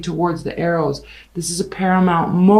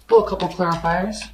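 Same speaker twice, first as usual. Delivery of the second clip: normal talking voice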